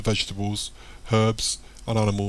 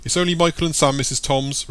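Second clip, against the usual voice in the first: raised, higher-pitched reply; calling back; 5 semitones higher, 4 dB louder than usual. A narrator's reading. Mike answers Missus Tom's through the door, calling.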